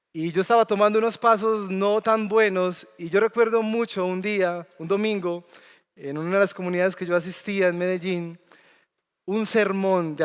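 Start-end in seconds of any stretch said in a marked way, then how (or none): none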